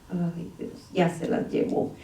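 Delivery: Ay, Dios breathed under her breath; a tired whisper to herself at work; whispered aside. Retold or imitated. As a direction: mutter it to yourself, almost unvoiced.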